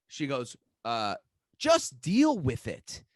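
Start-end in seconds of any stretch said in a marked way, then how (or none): 1.6-3.0 higher, slow, drawn-out retort; mock dismissive put-down; imitated dismissive sister. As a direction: mimic your sister brushing you off.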